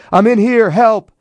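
high, loud shout for help; full-voiced, high-pitched cry for help. A narrator's reading. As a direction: yell out to be rescued. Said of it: "and shouted".

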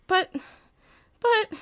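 high, tearful stammering. Acted. Swanee blinking back tears to Osmond, high tearful stammer. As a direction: choke on the words through tears. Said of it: "Swanee blinks back inky tears".

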